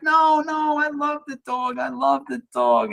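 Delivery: reassuring gush. Retold or imitated. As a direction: brush off the apology eagerly. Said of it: "I was like".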